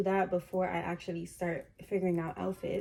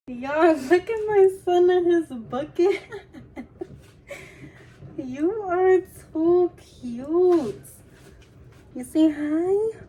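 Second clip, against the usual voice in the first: doting on dog; 9 semitones higher, 9 dB louder, slower than usual. Real, not acted. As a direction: coo at your puppy in the bucket hat. Baby talk to her dog.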